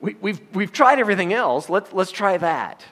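mock-exasperated suggestion, pitched high; sarcastic plea, slowing at the end. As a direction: propose it as if it were a serious last resort. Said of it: "I'm being sarcastic".

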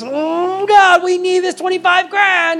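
anguished plea. Retold or imitated. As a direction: wring it out like a desperate prayer. Pastor agonizes over giving money.

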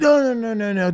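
high, rushed denials from a nervous producer; panicked producer. Retold or imitated. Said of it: "He's like".

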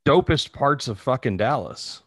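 ordinary delivery

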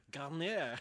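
Garner said like French "gar-NYAIR"; mock-French Garner. Topping co-host's joke on the author's name, mock-French Garner.

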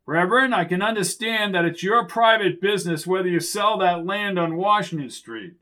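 high, carrying pulpit oratory without real volume. A narrator's reading. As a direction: proclaim it as if from a pulpit. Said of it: "Gallagher's booming pulpit voice resounded".

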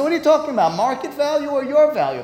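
high, outraged disbelief; incredulous retort. Acted. Teacher playing the creditor, scoffing at the offer in high disbelief.

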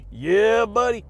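loud, pumped-up yeah buddy; hyped catchphrase. Real, not acted. Fired-up vlogger hollering his catchphrase at viewers. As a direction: bellow it like a gym bro.

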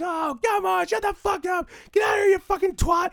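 high, loud mock crowd shouting at the heckler; be the whole audience yelling him out; angry crowd yelling. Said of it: "the whole theater's erupting".

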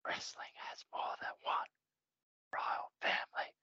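hushed, whispered entrance-theme opening; whispered intro. Retold or imitated. Whispering the theme's opening as the lights go out.

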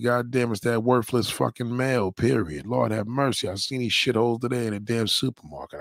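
ordinary delivery

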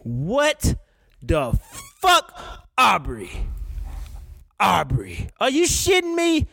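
disbelief, then shout it; stunned outburst. Real, not acted. Host reacting to [name]'s lyric, shocked and shouting. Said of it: "I couldn't believe what the fuck I was hearing".

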